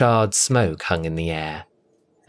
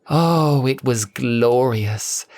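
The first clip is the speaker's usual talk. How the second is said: rapturous; 3 semitones higher, slower than usual. A narrator's reading. Cassandra revelling in breathing.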